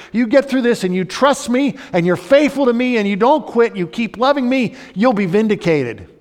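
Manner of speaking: loud, high, urgent preaching; a rousing sermon climax; preaching at full force. Acted. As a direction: drive it home to the congregation.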